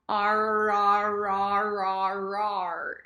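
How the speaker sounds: "Ar" run together on a monotone, loud at first; roaring "Ar". Shown as a demonstration. Teacher imitates roaring creatures, chained "Ar". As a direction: roar "ar" repeatedly like a tiger. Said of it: "roaring creatures and just go".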